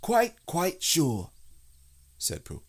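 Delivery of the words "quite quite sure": high, slow, deliberate; assuring bear. A narrator's reading. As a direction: insist it carefully as the bear. Pooh assures Rabbit.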